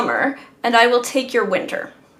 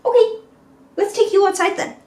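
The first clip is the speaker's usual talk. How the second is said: higher, quick, bright okay; chirpy dog talk; 8 semitones higher, 4 dB louder, faster than usual. Real, not acted. Owner cheerfully taking the dog outside. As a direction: perk up for the dog.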